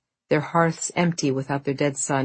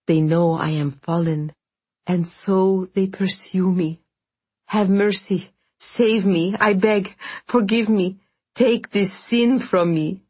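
raised, pleading, voice climbing with each plea; desperate begging; 5 semitones higher, 5 dB louder than usual. Acted. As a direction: beg for forgiveness as if your soul depends on it. Konstantin begs the voice for mercy.

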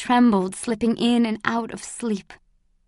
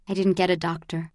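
usual speaking voice

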